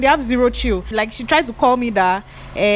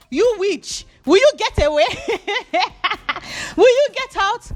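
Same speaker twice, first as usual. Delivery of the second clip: angry mother; 13 semitones higher than usual. Retold or imitated. The boyfriend's mother chasing Ruth from the gate, shrill mock yell. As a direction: shriek her away from the gate. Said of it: "boyfriend's mother said".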